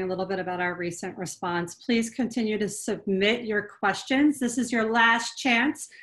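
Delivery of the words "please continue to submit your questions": ordinary talk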